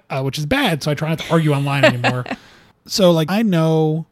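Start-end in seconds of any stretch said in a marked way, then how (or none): none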